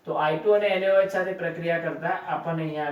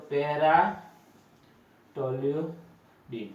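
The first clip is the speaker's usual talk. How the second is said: drawn-out naming; 3 semitones lower, much slower than usual. Shown as a demonstration. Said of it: "teacher names compound while writing".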